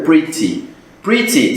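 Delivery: "pretty" with a fully sounded T; British crisp T. Shown as a demonstration. Teacher shows how most would read it, T sounded. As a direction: say "pretty" with a true T, British style. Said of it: "seria um sotaque britânico".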